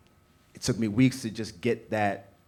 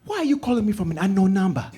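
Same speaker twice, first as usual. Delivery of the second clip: high, loud, laughing mom question; loud high-pitched mother; loud puzzled mom; 11 semitones higher, 8 dB louder than usual. Retold or imitated. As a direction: demand it, high and amused.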